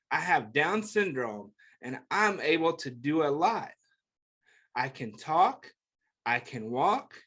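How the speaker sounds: gentle picture book read-aloud; reading to children. Acted. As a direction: read it like a bedtime book for kids.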